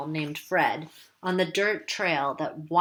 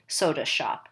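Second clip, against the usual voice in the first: ordinary delivery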